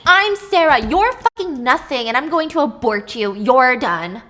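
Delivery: Sarah screaming at her double to back off; furious yelling. Acted.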